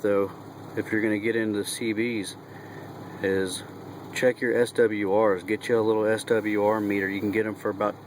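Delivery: normal talking voice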